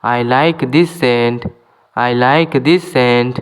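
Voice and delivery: slow, clear English for learners; careful dictation pace; slow teaching demo. Shown as a demonstration. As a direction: spell each word out slowly for students. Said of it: "teacher repeats example slowly".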